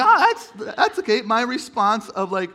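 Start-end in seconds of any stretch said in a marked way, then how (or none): 0.0-1.2 very high, loud, hammed-up squeak; loud falsetto fake okay; mock hurt reply. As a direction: squeal it as if pretending it doesn't hurt. Acting hurt over shirt remark.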